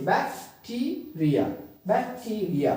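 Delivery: बैक्टीरिया split into bak, tee, ria; spaced-out syllables in बैक्टीरिया. Shown as a demonstration. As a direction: break बैक्टीरिया into separate syllables. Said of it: "अलग-अलग syllable को".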